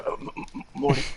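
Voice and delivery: burp through the words; stammered, burpy mumble, imitating a cartoon drunk; words broken up by mock burps; Rick. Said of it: "the way that he burped in the pilot".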